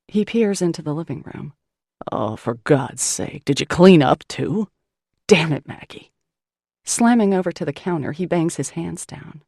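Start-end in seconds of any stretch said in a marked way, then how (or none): 2.1-6.1 fed up, he spits it at her; surly man finding his house cleaned, growled; exasperated gruff man. Acted. Malone angry at cleaned house.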